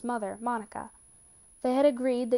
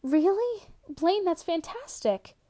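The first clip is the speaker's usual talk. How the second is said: high, bright burst of joy, slowing on the praise; thrilled; 8 semitones higher, 4 dB louder, slower than usual. A narrator's reading. Kurt thrilled by Blaine's career news, excited and slow. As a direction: cheer him on, delighted. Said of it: "Kurt grinned widely".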